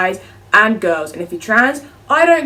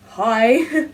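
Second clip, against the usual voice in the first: normal talking voice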